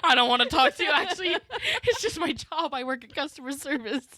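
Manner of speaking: high, loud, laughing teasing voice; laughing mock cashier. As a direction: tease her through laughter. Mocking friend's cashier persona.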